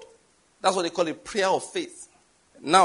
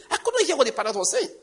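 exasperated; 9 semitones higher than usual. Retold or imitated. Exasperated recall of the noise.

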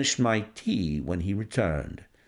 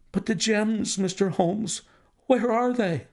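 higher, pleading banker's voice; anxious banker; 9 semitones higher than usual. Acted. The banker begging Holmes about the gems, higher. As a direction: clutch at Holmes for an answer.

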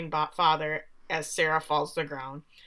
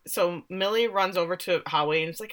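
usual speaking voice

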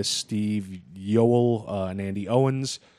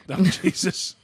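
laughing out a groan; Jesus through laughter; 10 semitones higher, 4 dB louder, slower than usual. Real, not acted. Reacting to partner's joke.

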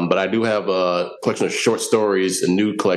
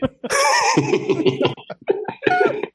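high-pitched laughing. Real, not acted. Reacting to the joke.

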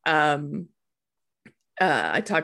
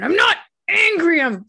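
put-on high, loud child's protest; indignant little boy; 13 semitones higher, 6 dB louder than usual. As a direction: squeak it out like an offended four-year-old. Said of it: "And he went".

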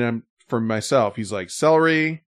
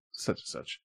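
whisper; 17 dB quieter than usual. Real, not acted. Trailing off in a whisper.

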